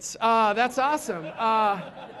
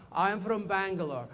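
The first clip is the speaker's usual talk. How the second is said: careful, softer mock Indian accent; Indian student; 4 semitones lower, 5 dB quieter than usual. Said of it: "students from India".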